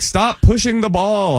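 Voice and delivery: slow, high, patient instruction; slow high advice. Retold or imitated. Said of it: "They're like".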